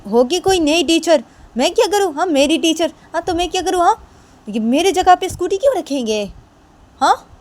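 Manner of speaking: loud, very high insolent retort; rude defiance. Acted. Kajal rudely dismisses the new teacher, loud high defiance. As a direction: sneer that you don't care. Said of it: "तो तभी काजल बोलती कि".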